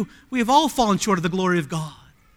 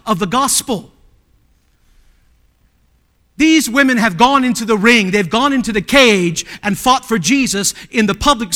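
louder, ringing pulpit delivery; impassioned sermon voice; full-force preaching; 4 semitones higher, 11 dB louder than usual. Acted. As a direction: preach it out to the congregation.

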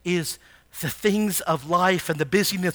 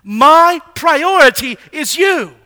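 slow, loud, higher with you stressed; emphatic devotion; 8 semitones higher, 14 dB louder, slower than usual. Acted. Preacher restating the psalmist's vow, slow and loud. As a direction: lean hard on you, drawn out. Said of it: "That's what he's saying".

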